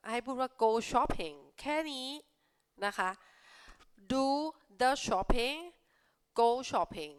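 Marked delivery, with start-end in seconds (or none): 4.1-5.6 teacher stressing the right phrase; emphatic answer. Shown as a demonstration. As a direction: do the shopping with the punched high and loud. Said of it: "stresses correct answer".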